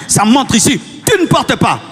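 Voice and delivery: ordinary talk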